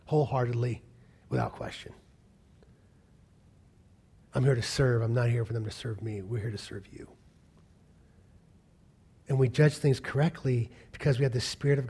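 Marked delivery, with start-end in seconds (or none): none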